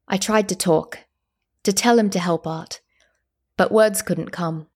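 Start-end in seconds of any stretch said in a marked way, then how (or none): none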